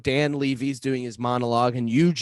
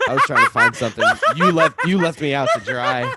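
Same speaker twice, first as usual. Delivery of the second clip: louder, amused accusation; laughing complaint; 7 dB louder than usual. Real, not acted. To co-host who left him hanging, laughing loud protest.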